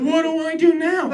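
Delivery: high-pitched helpless confusion; mock bewildered customer voice; clueless shopper. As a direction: ask it like you're totally lost. Acting a clueless test shopper.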